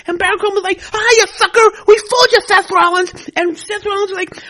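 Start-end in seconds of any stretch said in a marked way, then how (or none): 0.9-3.3 higher and much louder, jeering; taunting shout. Acted. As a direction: rub it in his face. Corbin taunts betrayed Rollins.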